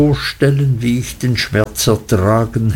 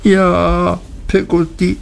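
higher, drawn-out, mildly tearful yes; sobbing reply; 6 semitones higher than usual. A narrator's reading. Davy to Peggotty through the door, mildly sobbing. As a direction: answer with a touch of a sob. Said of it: "schluchzte ich".

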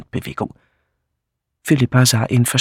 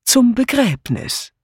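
ironic; 11 semitones higher, slower than usual. Acted. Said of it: "antwortete Miss Blacklock ironisch zwinkernd".

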